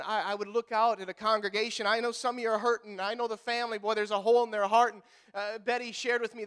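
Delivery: ordinary delivery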